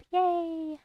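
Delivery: high, drawn-out little whoop; playful cheer. Real, not acted. Player delighted at blowing things up, playful high cheer.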